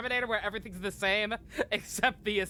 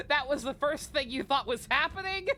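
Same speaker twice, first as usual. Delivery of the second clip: ordinary delivery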